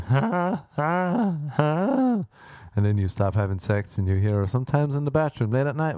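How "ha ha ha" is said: three high, separate "ha"s; mock orgasm cries. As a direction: imitate odd climax yelps. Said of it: "she has an orgasm like".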